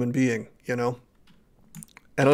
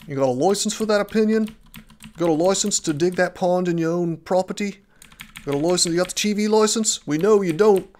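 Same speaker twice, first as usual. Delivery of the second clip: higher, hammed-up British accent; terrible British accent; 5 semitones higher, 4 dB louder than usual. Streamer mocking British licence checks, hammed-up bad British accent. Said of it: "my terrible British accent".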